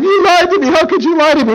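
high, mock-wounded woman; hurt accusation. Delilah confronts Samson the third time, mock-hurt accusation. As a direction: accuse him in a hammed-up high voice.

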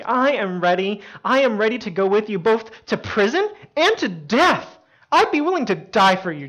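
loud, high declaration of loyalty; fervent vow. Retold or imitated. Peter pledging his life to Jesus, fervent. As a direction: act out swearing devotion with full force.

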